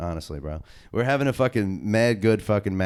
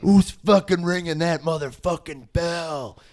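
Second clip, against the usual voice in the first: high, slow mock scream; Vince McMahon; 8 semitones higher than usual. Wrestler retelling his fury at the early bell, screaming. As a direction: drag out a hammed-up enraged demand. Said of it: "Fucking screaming and shit".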